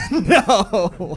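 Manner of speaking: high, loud mock "no"; hammed-up guilty denial; unconvincing denial. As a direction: blurt a guilty, comically high no. Mock denial to police, laughing.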